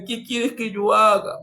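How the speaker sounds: súplica sumisa imitada, voz más aguda; mansito, imitado. Retold or imitated. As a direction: imita a un hombre rendido que pregunta en tono agudo. (sumiso, en registro alto)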